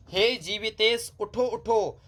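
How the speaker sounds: "हे जीवितेश उठो उठो" recited high and slow; recited line. Shown as a demonstration. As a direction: lift "उठो उठो" like poetry, slowly. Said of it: "अब आप समझ सकते हैं जीवितेश उठो उठो".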